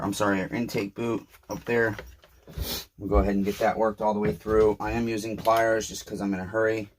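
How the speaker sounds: ordinary talk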